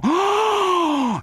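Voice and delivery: very high, sharp startled "Oh"; a loud squeak of shock; big startled oh. A narrator's reading. Man at the door lets out a very high startled oh. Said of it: "poussa un grand".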